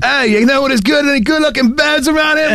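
high, hammed-up British tourist; mock British accent. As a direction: call out in a cartoon British accent.

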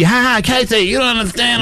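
put-on high, giddy drunk outburst; happy drunk glee, high-pitched. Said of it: "I'm a happy".

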